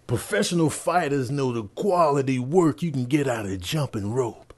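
ordinary talk, nothing marked

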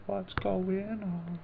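high, drawn-out hello to the stream; cheery call-out to live viewers; sing-song greeting. Acted. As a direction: stretch the greeting up high and slow. Greeting people who joined live.

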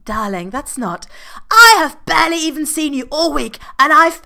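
high, loud exasperated shouting; furious partner yelling through the door; angry yelling. Acted. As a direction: shout back in frustration. Freddy yelling back in quarrel.

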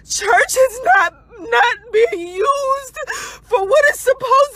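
tearful, breaking voice as she pleads; crying. Real, not acted. Woman on livestream condemning her church, crying. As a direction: speak through real tears, pressing on.

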